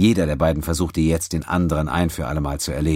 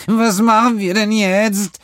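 high, slow, trembling question; frightened; 6 dB louder, slower than usual. Acted. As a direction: ask it scared and helpless. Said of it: "vor Angst geweitet".

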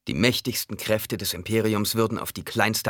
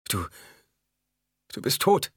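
halting, higher-pitched gasp of shock; 5 semitones higher than usual. A narrator's reading. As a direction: gasp it out after a stunned pause. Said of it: "keuchte Alia". (keuchend, stockend und hoch)

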